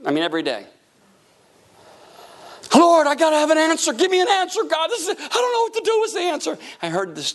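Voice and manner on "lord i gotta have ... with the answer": high-pitched mock pleading; frantic, whiny cry for an answer; desperate prayer. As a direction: beg God urgently in a hammed-up high voice. Desperate prayer after months of arguing.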